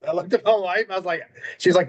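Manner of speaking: ordinary talk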